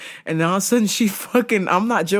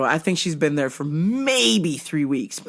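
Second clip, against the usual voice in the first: normal talking voice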